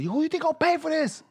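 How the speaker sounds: high mock scolding mom voice. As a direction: demand it like a furious mother. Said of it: "she said".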